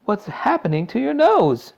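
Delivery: high, loud, alarmed question; horrified mother, pitched high; mild shriek. A narrator's reading. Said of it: "shrieked Miss Beauregard suddenly".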